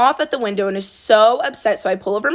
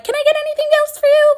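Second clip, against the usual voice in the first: very high, fast, sing-song service voice; super annoying customer service voice; 4 dB louder, faster than usual. Retold or imitated. Barista to rude customer, very high fake-cheerful service voice. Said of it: "in my super annoying customer service voice".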